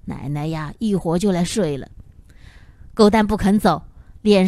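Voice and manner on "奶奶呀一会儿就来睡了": put-on softer grandmother voice, hushing a crying boy; grandma quietly calming her grandson at night, softly. A narrator's reading. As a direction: soothe the little boy back to bed in a low voice. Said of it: "小声道".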